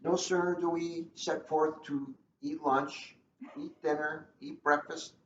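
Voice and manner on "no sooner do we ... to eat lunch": usual speaking voice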